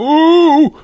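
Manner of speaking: high falsetto ooh; hammed-up high moan; high mock moan. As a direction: squeal it like a man screaming. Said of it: "he going".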